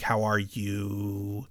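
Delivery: slow, you held long at the end; slow mock question, last word drawn out; stretched last word. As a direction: drag out the final you. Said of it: "he would extend the last word".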